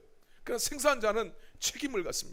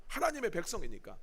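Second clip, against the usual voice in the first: ordinary talk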